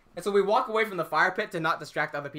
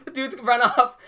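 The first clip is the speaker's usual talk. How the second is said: ordinary delivery, nothing marked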